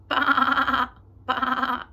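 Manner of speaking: loud bleating baa; sheep baa. Pretend. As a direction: bleat like a lamb. Sheep sound for child.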